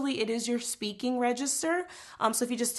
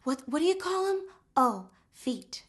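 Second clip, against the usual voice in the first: high, chatty Ariel speaking voice; 5 semitones higher than usual. Shown as a demonstration. Singing teacher demonstrating Ariel's speak-singing. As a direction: talk the line like the mermaid. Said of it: "she speaks a lot".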